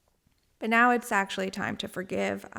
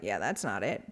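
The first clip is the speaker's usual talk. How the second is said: much lower, offhand dude voice; buddy's voice; 12 semitones lower, 6 dB quieter than usual. Retold or imitated. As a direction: drop low like a laid-back friend. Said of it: "he was like".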